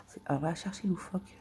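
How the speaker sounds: quick whispered aside; fast whisper. Real, not acted. Deciding to look it up, fast whisper. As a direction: rush it out under the breath.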